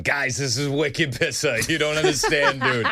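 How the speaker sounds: thick Boston accent, laughing; hammed-up wicked Boston guy. Shown as a demonstration. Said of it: "be thankful I'm not on here going".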